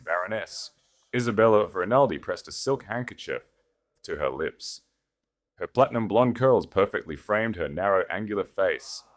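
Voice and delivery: ordinary delivery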